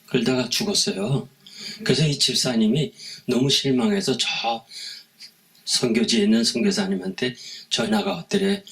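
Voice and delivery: ordinary talk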